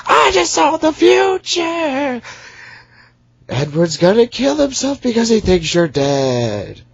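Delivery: very high, slow mock girl voice; mock her as annoyingly as you can. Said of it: "the annoying one's like".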